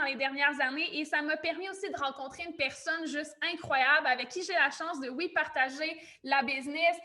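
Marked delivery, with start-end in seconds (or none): none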